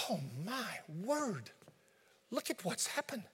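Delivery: soft, slow stunned gasp; aghast, under the breath; hushed dismay. Acted. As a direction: believer seeing the mess, quietly stunned. Acted shock after carnality explosion.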